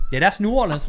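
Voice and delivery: offhand, shrugging delivery; real casual. Retold or imitated. Counter guy shrugging off a chipped tooth, casual. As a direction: toss it off like it's nothing. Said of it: "Just like that, real, real casual".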